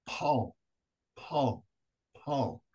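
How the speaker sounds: soft, falling ghostly call; hushed imitation of a woman calling; ghostly woman's voice. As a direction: imitate a spirit quietly calling, each call separate. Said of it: "you can hear a woman's voice go".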